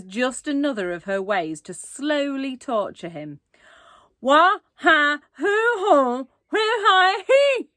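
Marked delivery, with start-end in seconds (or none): 4.2-7.7 high, loud and slow garbled words; toothless shout. A narrator's reading. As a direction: shout slowly with no teeth. Toothless boy shouting again.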